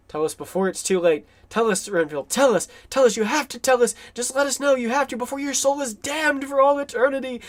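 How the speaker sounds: acted plea, climbing high; mounting, hammed-up urgency; urgent demand. Acts out urgent interrogation of Renfield.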